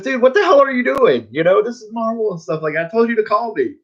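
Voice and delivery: high-pitched mock telling-off; tongue-in-cheek scolding. Editor chides artist for not calling, tongue-in-cheek and high. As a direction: scold playfully, voice pitched well up. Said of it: "he said it very tongue in cheek".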